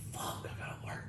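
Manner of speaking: hushed whisper to himself; retold whisper. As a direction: breathe it out under your breath, panicked. Muttering his panic on the phone.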